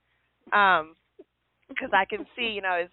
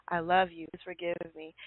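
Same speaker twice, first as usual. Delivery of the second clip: much softer, lower, repeated under the breath; quiet mantra; 4 semitones lower, 7 dB quieter than usual. Retold or imitated. Wife calming herself in parking lot, soft murmured mantra. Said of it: "I'm saying it, I'm saying it".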